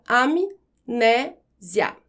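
"amnésia" slow, am, né, sia; syllable by syllable. Shown as a demonstration. Teacher putting M and N apart, syllable by syllable. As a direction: pause after each syllable of "amnésia". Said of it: "quando eu separo essas sílabas".

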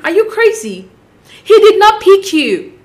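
indignant. Real, not acted. Vlogger scolding Sarah Ann on screen, slow indignant rise.

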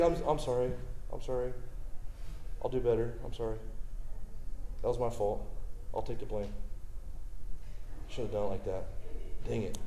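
sorry and meek, giving in gently; apologizing humbly after upsetting his wife and kids; humble apology. Retold or imitated. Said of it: "they get angry at me".

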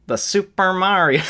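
pinched nasal voice, as if holding nose. Pretend. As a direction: talk through a pinched nose. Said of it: "It sounds like I'm holding my nose".

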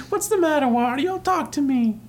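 high cartoon Mario asking; Mario's voice. Mario voiced in comic retelling.

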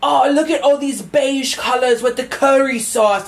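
high, slow mock British voice gushing; mocking British accent. Mocking British TikTokers in a British accent, high and slow. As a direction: ham up a British takeaway fan in a British accent. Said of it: "they're like".

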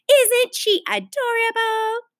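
high, drawn-out cooing; gushing. A narrator's reading. Neighbour admires little bunny to her friend, gushing.

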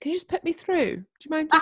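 high, soft, mock-polite phone request; caller to operator. Pretending to ask operator to connect, mock polite. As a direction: ask sweetly as if to a switchboard. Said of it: "It's like an operator".

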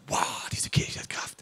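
whispered slowly in mock wonder; awed whisper. Preacher mocking his naive younger self, awed whisper. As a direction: breathe it out, amazed. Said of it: "Und ich so".

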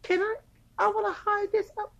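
very high mock voice of a German coworker. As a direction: squeak her request like a prissy coworker.

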